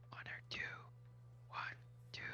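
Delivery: barely audible whispered counting. Acted. Player whispers to a companion at the shop counter, whispered. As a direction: whisper it low, under the breath.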